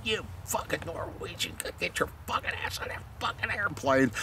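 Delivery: a furious whispered threat, high and tight; hissed fury. Retold or imitated. Tom hissing at the Norwegian lieutenant, furious whisper. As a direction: spit it out under the breath in rage. Tom's retold tirade at Norwegian.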